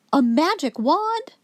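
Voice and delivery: ordinary delivery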